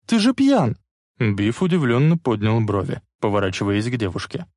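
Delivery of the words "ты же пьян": high-pitched indignant cry; girl's cry. A narrator's reading. Girl confronts drunk bully from car, high cry. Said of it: "закричала".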